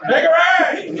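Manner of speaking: high, loud cue shout; host giving himself the cue shout. Real, not acted. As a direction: shout the cue word at the crowd.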